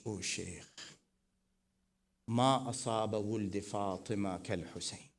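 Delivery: ordinary talk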